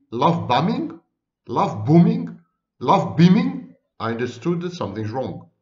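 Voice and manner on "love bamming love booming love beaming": imitating baffled listeners, louder with each guess; puzzled questioning. Viewers asking what he said, puzzled questioning. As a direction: repeat it as mock-confused questions, building in volume and pitch. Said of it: "people kept asking me".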